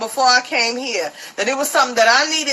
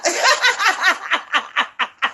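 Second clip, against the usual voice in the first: laugh. Real, not acted. Laughing at own story.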